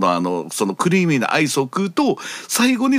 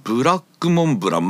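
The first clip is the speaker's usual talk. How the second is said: ordinary talk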